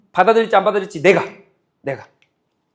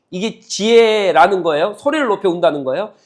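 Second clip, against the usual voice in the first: ordinary talk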